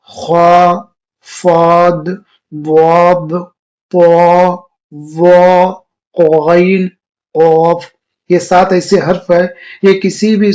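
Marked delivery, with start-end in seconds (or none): 0.1-7.8 خا, ضاد, قاف said loud and thick, each alone; heavy letters. Shown as a demonstration. Teacher calls out the seven heavy letters loudly. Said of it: "حروف مستعلیہ کون کون ہے؟".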